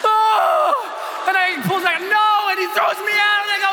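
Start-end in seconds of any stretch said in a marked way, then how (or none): 0.1-1.3 acted terrified howl; falling scream. Thrown out of the plane. 2.0-2.5 high-pitched panicked cry; acted last-second terror; terrified refusal. Reenacts being thrown out.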